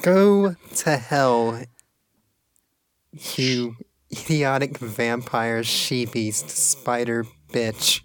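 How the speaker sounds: very slow, drawn-out insult; Tallulah's slow curse. Acted. Tallulah cursing her captor, very slow and deliberate. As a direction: spit each word out slowly.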